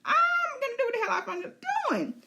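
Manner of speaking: high, laughing, defiant dragon voice; the stubborn dragon refusing to retreat; cocky beast laughing off orders. Pretend. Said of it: "And Sheep Stealer's like".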